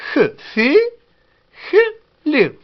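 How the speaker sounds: Che, fichlin drawn out very slowly and high, syllable by syllable; slow teaching demo. Shown as a demonstration. Teacher slowly modelling the Kabyle word for students. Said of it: "Très facile".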